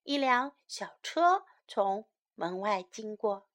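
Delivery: ordinary delivery, nothing marked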